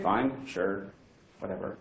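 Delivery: a halting, unsure shrug of an answer; hesitant. Retold or imitated. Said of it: "I'm hesitatingly".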